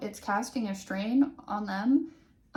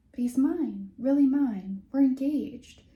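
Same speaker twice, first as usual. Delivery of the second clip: higher, louder, giddy reassurance to herself; self-reminding glee; 4 semitones higher, 6 dB louder than usual. Acted. Maria reminding herself of the engagement, giddy and louder. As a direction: insist it to yourself, thrilled. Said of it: "she reminded herself".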